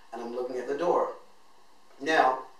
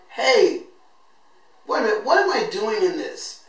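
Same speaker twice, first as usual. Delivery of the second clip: sudden outcry; 8 semitones higher, 8 dB louder than usual. Retold or imitated. Retold sudden outburst in class.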